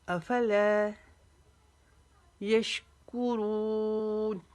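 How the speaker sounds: slow Quran recitation, long drawn vowels; recitation with pauses. Shown as a demonstration. Teacher reciting the verse for students, slow tajweed with stops. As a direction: recite phrase by phrase, stopping after each. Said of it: "वक्फे के साथ पढ़ेंगे".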